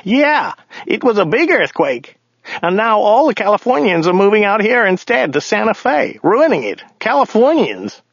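high-pitched character voice for the driver; grumbling driver. A narrator's reading. Driver complaining to his passenger about Californians, high character voice.

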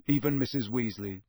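usual speaking voice

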